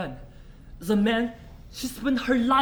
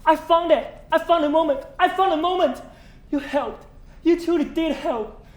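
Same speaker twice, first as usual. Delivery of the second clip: breathless, laughing rush of joy, higher and louder; excited; 5 semitones higher, 7 dB louder than usual. Acted. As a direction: burst in thrilled with the news. Overjoyed reunion, found the memory.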